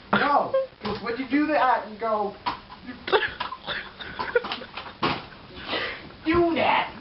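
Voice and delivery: a hammed-up emotional outburst; mock emotional. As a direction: voice rising high, worked up. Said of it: "I get emotional".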